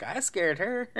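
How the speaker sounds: high, indignant retort, rising; hammed-up offended question; mock incredulous echo. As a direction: throw it back in exaggerated disbelief. Retold indignant disbelief.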